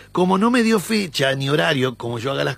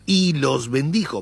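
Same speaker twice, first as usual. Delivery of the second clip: "Y" stressed and slowed; slower than usual. Shown as a demonstration. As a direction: lean on the "Y", read slowly. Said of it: "Yo voy a darle énfasis en la "y". (la "Y" enfatizada y lenta)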